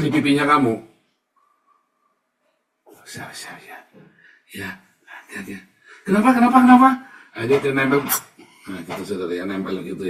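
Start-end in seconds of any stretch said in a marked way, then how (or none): 3.1-3.8 unvoiced hushed whisper. Preacher mocking a man who whispers to children, whispered. 4.5-5.6 unvoiced hushed whisper. Preacher mocking a man who whispers to children, whispered. 6.0-6.9 much higher, loud laughing kenapa; startled laughing child. Retold or imitated. Preacher acting the child pulled close, high laughing kenapa. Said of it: "acted child's startled reply".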